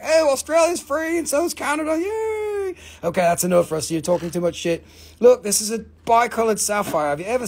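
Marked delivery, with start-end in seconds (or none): 0.0-2.8 high, cheering mock jubilation; mock celebration. Everyone celebrating once the Queen is gone, mock jubilant. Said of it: "everyone's gonna be like".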